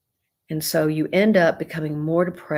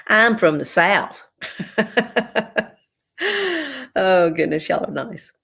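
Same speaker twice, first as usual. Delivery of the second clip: Southern accent; 5 semitones higher than usual. Real, not acted. Answering a compliment on her accent.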